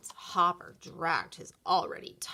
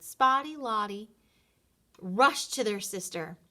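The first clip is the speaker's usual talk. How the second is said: usual speaking voice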